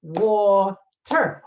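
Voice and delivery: Water split into its syllables, wa-ter; counting the syllables in Water aloud; sounding out syllables. Shown as a demonstration. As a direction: say Water slowly, beat by beat. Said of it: "Oh, two syllables".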